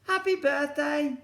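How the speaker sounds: put-on high, slow child voice; shy little boy. Alfie wishes Bernard happy birthday, high child voice. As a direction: say it carefully like a small boy.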